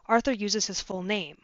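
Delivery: normal talking voice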